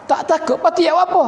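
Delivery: high-pitched puzzled question; acted puzzlement. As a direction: question it as the baffled king, pitch well up.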